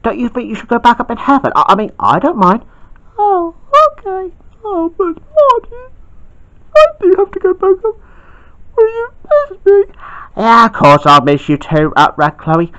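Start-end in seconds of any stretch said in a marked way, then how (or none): 3.2-9.8 high and sad, leaving; high girl voice. Acted. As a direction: ask Artie if he'll miss her. Girl figure leaving for heaven.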